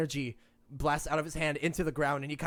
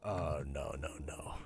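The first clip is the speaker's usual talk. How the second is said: low, breathless whispered denial; frightened whisper; 14 semitones lower, 10 dB quieter, slower than usual. Acted. The captive whispers in dread when pressed about Sam. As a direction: whisper it, terrified.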